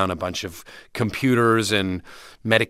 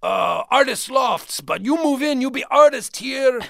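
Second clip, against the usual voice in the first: put-on thick Dutch accent; 13 semitones higher than usual. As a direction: play the crazy Dutch landlord with his Dutch accent. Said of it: "he had this thick Dutch accent".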